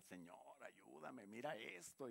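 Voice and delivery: mucho más suave y bajo, como confidencia; pídeselo casi en secreto; oración susurrada. Retold or imitated. Imita cómo le hablamos a Dios. (imitando a un creyente que ora, muy suave)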